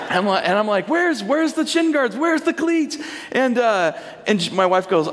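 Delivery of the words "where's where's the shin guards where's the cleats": high-pitched, flustered questions; frantic questioning. Retold or imitated. Dad asking wife for soccer gear, acted panic. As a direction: act out a desperate parent on the phone.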